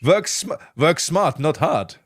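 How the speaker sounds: put-on comic German-accented catchphrase. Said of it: "Why am I doing a German accent".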